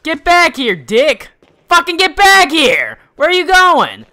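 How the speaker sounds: high, loud angry shouting; furious yelling. Real, not acted. Player shouting at a fleeing monster, furious and loud.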